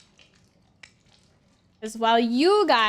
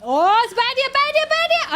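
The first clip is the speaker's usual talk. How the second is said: high, loud panicked shouts; panicked yelling; 13 semitones higher, 8 dB louder than usual. Real, not acted. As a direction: yell the warning in a panic. Panicked spotting the bandit.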